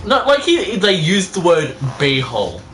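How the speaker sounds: ordinary delivery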